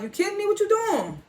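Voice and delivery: high-pitched, incredulous outburst; shocked disbelief. Retold or imitated. Gordo reacting to his brothers threatening Drew, shocked. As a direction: squeak it out like you can't believe it.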